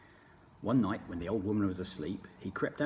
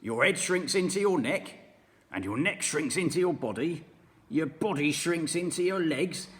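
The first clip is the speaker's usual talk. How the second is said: put-on louder, higher gloating old man; sneering husband, loud; mean old husband taunting his wife; Mr Twit; 8 semitones higher, 8 dB louder than usual. Mr Twit's dialogue.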